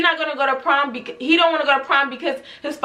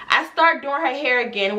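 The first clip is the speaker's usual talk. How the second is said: ordinary talk, nothing marked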